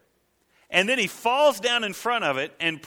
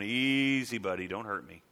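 mock-timid plea, lower and softer; mock fear; 8 semitones lower, 4 dB quieter than usual. Preacher imitating a man backing away from a scarecrow, mock fear.

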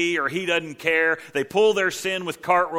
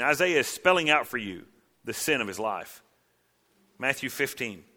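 ordinary delivery

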